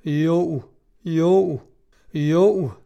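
drawn-out, swelling cries of assent; crowd shout. A narrator's reading. Crowd roars agreement to its chieftain, lightly shouted. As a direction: call each "Jo" out, building. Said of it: "råbte de så højt".

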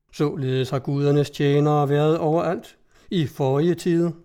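ordinary delivery, nothing marked

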